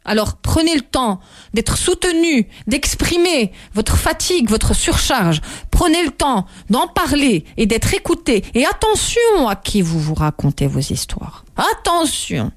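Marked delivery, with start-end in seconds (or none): none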